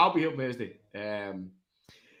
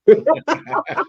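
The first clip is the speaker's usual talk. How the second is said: loud high laugh; 12 dB louder than usual. Real, not acted. Drunken banter, big laugh.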